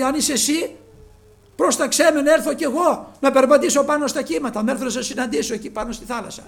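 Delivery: eager plea. Acted. Peter eagerly asks Lord.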